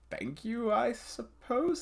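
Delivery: slow, drawn-out hesitant thanks, pitched high; slowly. A narrator's reading. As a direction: stretch the words out reluctantly. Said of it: "Siri says slowly".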